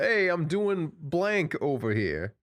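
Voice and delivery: high, drawn-out Italian chef call. Shown as a demonstration. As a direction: announce it like a put-on Italian chef. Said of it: "Italian chef voice is just better".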